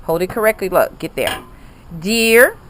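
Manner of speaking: ordinary talk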